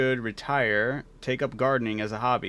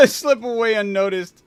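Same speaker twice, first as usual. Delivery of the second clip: high, loud laughing refusal; laughing defiance; 9 semitones higher, 7 dB louder than usual. Real, not acted. Dodging the debt, laughing.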